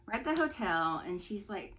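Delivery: ordinary delivery, nothing marked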